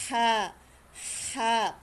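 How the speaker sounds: HA sounded from mid-throat, like panting; breathy throat sound. Shown as a demonstration. Teacher showing students the Arabic letter, panting HA. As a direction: push "Ha" out like a runner's pant.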